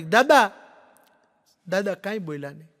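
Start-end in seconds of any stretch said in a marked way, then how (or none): 0.0-0.5 calling out. Retold or imitated. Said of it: "મેં બાપાને બૂમ પાડી".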